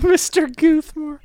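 1 squeaky laughing repeat. Pretend. Laughing high mock repeat.

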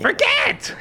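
very high shriek of forget; shrieking witch. Acted. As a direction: screech it like a mad witch. Witch bonks Crick again.

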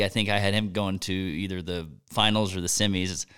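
usual speaking voice

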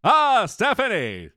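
hammed-up grand stage actor; Brian Blessed. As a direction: proclaim it like an exuberant thespian. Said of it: "Brian Blessed".

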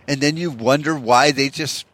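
ordinary talk, nothing marked

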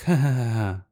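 put-on brush-off laugh; mock dismissive laugh. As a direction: fake ha-ha-ha. Mother laughs off the accusation.

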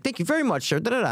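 high, chirpy imitated cashier thanks; very cheerful. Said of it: "Very, very cheerful".

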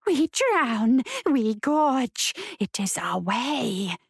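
eerie female spirit, slowing at the end. A narrator's reading. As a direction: intone it like a ghostly woman, drawing out the close. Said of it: "the female said".

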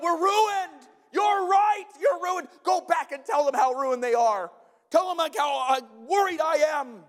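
hammed-up panic, high and loud; over-the-top shouted alarm, played for effect; mock panicked response. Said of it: "what do you think Nehemiah's response is".

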